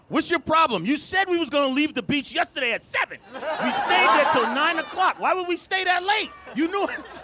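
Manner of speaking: high-pitched mock rant; indignant comic tirade; exasperated outburst. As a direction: let the pent-up grievance burst out. Finally erupting with grievance.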